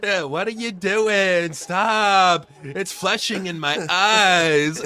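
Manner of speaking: mock Pauly Shore. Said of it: "to piss him off".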